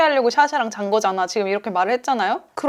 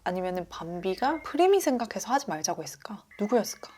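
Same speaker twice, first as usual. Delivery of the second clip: whisper; 3 semitones lower, 9 dB quieter than usual. Real, not acted. Teasing rhetorical guessing.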